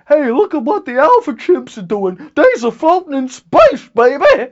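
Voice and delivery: high, loud hooting ape voice; dumb chimp voice. Retold or imitated. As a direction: play an excited alpha chimp. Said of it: "This is chimpanzee idiocy".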